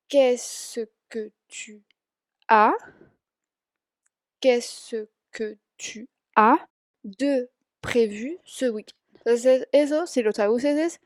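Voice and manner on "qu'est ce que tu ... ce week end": very slow, syllable by syllable French question; said slower. Shown as a demonstration. Teacher slowing a French question for students, very slow. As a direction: spell out Qu'est-ce que tu as de prévu slowly for learners. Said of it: "lo digo más lento".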